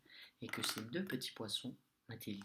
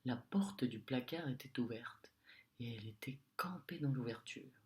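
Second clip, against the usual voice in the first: usual speaking voice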